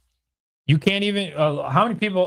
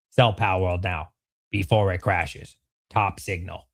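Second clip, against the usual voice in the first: lower, softer deadpan drone; mock deadpan read; 11 semitones lower, 3 dB quieter than usual. Streamer reading a viewer's chat aloud, in a low mocking drone. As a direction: read the comment like it's beneath you.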